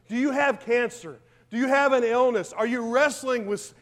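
pointed preacher question. Acted. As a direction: press the congregation to answer. Challenging the congregation directly.

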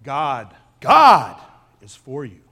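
God, is, for, you each given its own weight; slow deliberate stress on each word. Shown as a demonstration. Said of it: "he emphasizes each word".

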